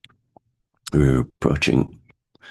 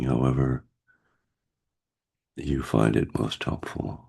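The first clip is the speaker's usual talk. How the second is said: guided meditation. Acted. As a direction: gently guide them into stillness.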